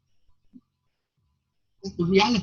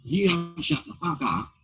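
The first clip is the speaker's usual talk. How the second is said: higher-pitched mock snap at the heckler; retold heckle comeback; 9 semitones higher than usual. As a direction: bark the threat like a cheeky comeback. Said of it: "all of a sudden I went".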